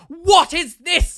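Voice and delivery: slow, high, very loud imitation; exaggerated screaming plumber. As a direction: bellow each word in mock outrage. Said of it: "literally screaming".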